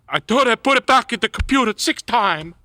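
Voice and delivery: a hint of a frazzled older clerk; mock cashier Lorraine. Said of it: "Like, Lorraine".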